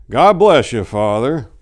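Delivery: a hint of a puzzled old man; lightly voiced old man. Old husband blesses priest.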